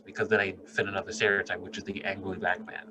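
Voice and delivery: usual speaking voice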